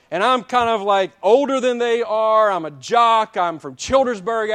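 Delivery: loud, driving sermon delivery, building the story; full-force preaching. Real, not acted. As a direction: preach it out to the whole church.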